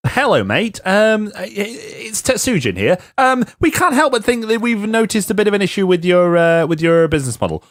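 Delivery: high, hammed-up polite phone voice; Tetsujin caller. Podcaster voicing Tetsujin on the phone, high and mock-polite. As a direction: play a prim caller raising an issue. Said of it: "if I were Tetsujin".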